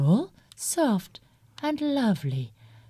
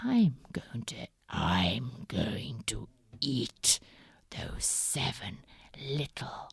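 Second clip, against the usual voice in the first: scheming whisper; 8 semitones lower, 6 dB quieter than usual. Acted. Wolf's scheming thought.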